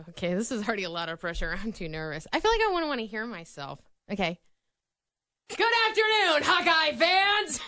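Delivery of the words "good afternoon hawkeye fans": big stadium announcer greeting. Pretend. Radio host trying out the stadium announcer voice. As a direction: boom it out like the stadium voice. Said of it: "your best, announcer voice".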